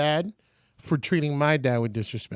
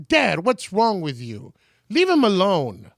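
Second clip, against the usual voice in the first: slow, louder reproach; scolding her dad; 3 semitones higher, 6 dB louder, slower than usual. Retold or imitated. Selena scolds her father for the rude treatment, slow and loud. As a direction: tell him off, slowly and firmly.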